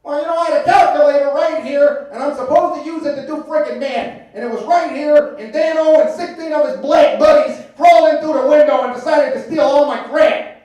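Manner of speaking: loud high cranky rant; Uncle Mike voice. Retold or imitated. Uncle ranting that his nephew stole his calculator, loud high voice. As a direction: spit out the old man's tirade. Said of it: "Uncle Mike rant".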